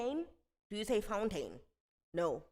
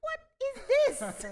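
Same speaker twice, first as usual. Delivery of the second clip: much higher, loud stunned question; flatmate's shrieked disbelief; mock shocked flatmate; 11 dB louder, slower than usual. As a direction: squeal it like a shocked friend. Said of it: "flatmate called me and was like".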